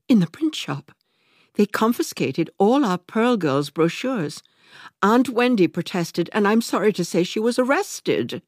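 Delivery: high, agitated report; breathless flustered telling; distressed aunt. A narrator's reading. Aunt Elizabeth reports the raid to Lydia, agitated high.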